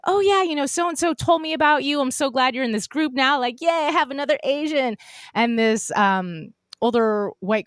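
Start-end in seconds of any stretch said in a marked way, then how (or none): 0.1-5.0 high-pitched, eager chatter; gushing welcome. Retold or imitated. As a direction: gush like a delighted new acquaintance. Retelling another woman's eager welcome.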